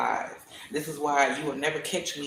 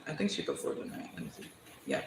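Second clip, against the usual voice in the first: soft whispered mutter to herself; whispered aside; 11 dB quieter, faster than usual. Real, not acted. Reader checking card order, whispering to herself. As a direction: mutter it under your breath.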